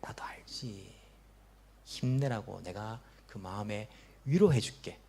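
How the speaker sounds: hushed, slow consoling words; tender whispered reassurance to the wounded; gentle whisper of comfort. Acted. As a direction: whisper it softly like Jesus comforting a hurt soul. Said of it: "우리 예수님께서 위로해 주십니다".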